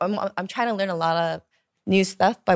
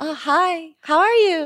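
much higher, nervous and eager; 10 semitones higher, 5 dB louder, slower than usual. Shown as a demonstration. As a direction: squeak it out nervously, way up high. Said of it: "my voice goes very high".

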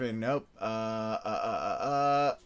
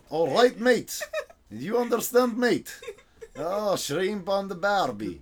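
high-pitched, hammed-up Australian accent; comic Australian accent; 5 semitones higher than usual. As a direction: do a cartoon Aussie accent, pitched up. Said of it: "It certainly was my Australian".

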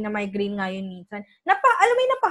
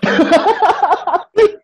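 big high burst of laughter; loud laugh; 7 semitones higher, 8 dB louder than usual. Real, not acted. Laughing at own story. (malakas na tawa)

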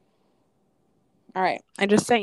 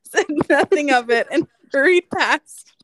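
high, helpless laughing through the words; laughing while talking; 6 semitones higher than usual. Real, not acted. Telling of the roommate who overheard, laughing. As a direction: struggle to tell it through real laughter. Cracks up telling embarrassing story.

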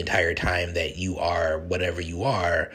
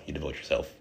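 usual speaking voice

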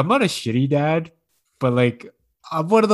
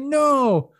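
high, drawn-out mock wail; high-pitched protesting little girl; crying child imitation; 12 semitones higher than usual. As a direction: imitate a kid wailing in refusal. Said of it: "and she's crying".

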